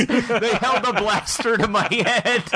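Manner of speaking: high, slow, laughing through the words; laughing impression. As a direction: giggle through a mock complaint. Lucas bit, laughing.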